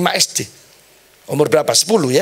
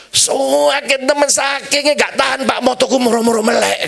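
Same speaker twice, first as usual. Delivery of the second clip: hammed-up patient moaning in a raised pitch; imitated pained complaint; 8 semitones higher than usual. Patient tells the pastor the pain opened his eyes, high mock wail.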